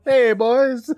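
put-on high, loud hail to the boys; calling out. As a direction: holler a hearty greeting across the room. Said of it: "calling out".

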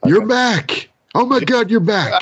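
high, bright thrilled greeting; overjoyed to see him back; excited welcome. Real, not acted. Host greets returning co-host.

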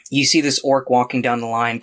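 usual speaking voice

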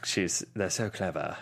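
low, slow film-actor impression; velociraptor line impression. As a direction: drop low and draw it out, imitating him. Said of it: "how he would talk about a velociraptor".

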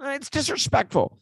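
high-pitched mock whining; John Harbaugh. Host mocking a coach who can't fight, high whiny impression. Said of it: "John Harbaugh starts, starts whining".

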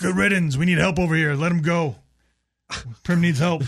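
high, loud call for help; urgent shouting. Acted. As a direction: yell it over the intercom. Yelling over the intercom in character.

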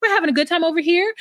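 fast, breezy reassurance; hurried reassuring. Retold or imitated. Quaylon's mother pulling Chevelle aside, fast and breezy. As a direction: rush her away kindly. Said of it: "Quaylon's mother comes over and is like".

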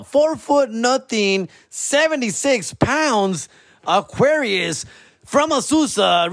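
high, slow hyped stadium announcer; booming pumped announcer. Retold or imitated. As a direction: stretch every word like a PA hype man. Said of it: "And when I come in, I'm like".

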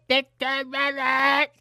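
high, slow shouted call. Pretend. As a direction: belt the jingle out high and slow. Show jingle call repeated.

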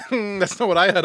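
normal talking voice

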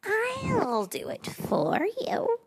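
slow, high honeyed purr. Acted. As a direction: coax it sweetly like a cat. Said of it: "he purred".

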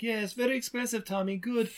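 high, airy mock dance teacher; ballet teacher impression. Podcaster mocking the ballet teacher, high and sing-song. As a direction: play the prissy ballet teacher calling a break.

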